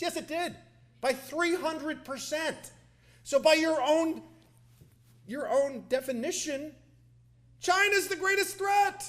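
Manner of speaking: high, slow, building to a shout; triumphant retort. Retold or imitated. Congressman cornering the secretary in committee, shouting his conclusion. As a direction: hammer each phrase up to a shouted conclusion. Retorts climax of retold questioning.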